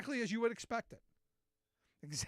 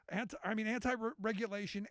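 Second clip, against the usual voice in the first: ordinary talk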